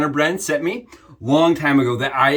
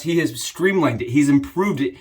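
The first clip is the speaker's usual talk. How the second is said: normal talking voice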